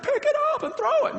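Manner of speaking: very high, comic plea to a fielder; mock urging. [name] pleading with teammates to field the ball, high-pitched urging. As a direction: squeal it out in exaggerated exasperation.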